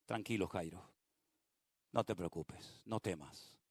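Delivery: soft, low soothing voice; calm Jairo down tenderly; Jesus softly comforting the frightened father; gentle reassurance. Acted.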